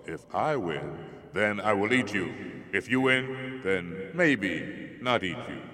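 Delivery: slow, booming simple giant; slow dim-witted giant. Acted. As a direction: drag the words like a dull giant. Giant figure voiced.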